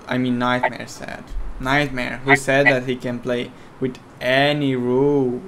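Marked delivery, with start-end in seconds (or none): none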